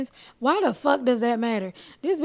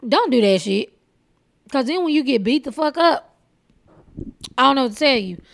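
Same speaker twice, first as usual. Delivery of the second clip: scolding; 2 semitones higher, 4 dB louder than usual. Real, not acted. Scolding the heckler.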